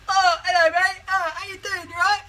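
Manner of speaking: high, hammed-up fake British accent, as Americans do it; mock fake British accent. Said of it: "think that we can talk in an American accent".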